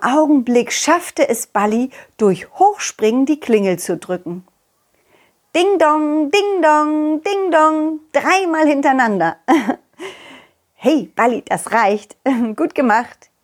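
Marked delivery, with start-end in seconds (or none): 5.5-8.0 doorbell imitation. Doorbell ringing.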